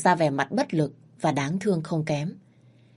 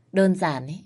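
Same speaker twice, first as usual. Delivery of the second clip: ordinary delivery, nothing marked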